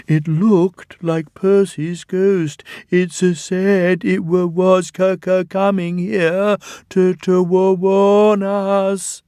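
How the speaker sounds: stammering, scared engine; shaky voice. A narrator's reading. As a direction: stutter it out, terrified. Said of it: "said Toby in a shaky voice".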